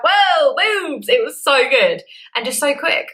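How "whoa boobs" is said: delighted whoa. Retold or imitated. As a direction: squeal with delight at the new shape. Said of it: "I'm like".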